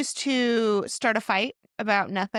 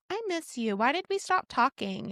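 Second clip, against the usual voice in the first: higher, softer imitation of the ex; lightly mimicking her sugary plea; mock sweet pleading; 5 semitones higher, 4 dB quieter than usual. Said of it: "and be like".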